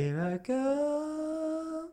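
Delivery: very high, very slow, soft sung-out cry; mock heroic cry. Mocking the hero boarding the jet, very high and slow. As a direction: float it up like a hero taking off.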